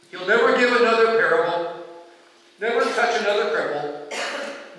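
normal talking voice

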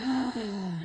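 long audible sigh out; exhaling sigh. Shown as a demonstration. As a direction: breathe out with a sigh. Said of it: "Exhale with a sigh".

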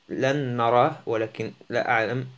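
normal talking voice

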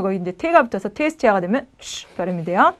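normal talking voice

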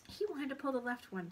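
plain reading voice

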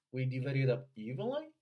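"we divide it up evenly" loud and linked, rising on a stressed "evenly"; model for repetition. Shown as a demonstration. Coach leading the third repeat, linked model reading. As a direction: loudly link "we divide it up", then stress "evenly". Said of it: "请跟读三遍".

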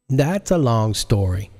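a lightly voiced slow Marise; Marise's line. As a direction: drag it out knowingly. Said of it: "she said".

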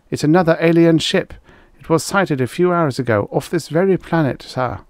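high-pitched, urgent military report; reporting officer. Acted.

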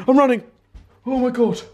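flee in panic; panicked. Real, not acted. Fleeing the bee.

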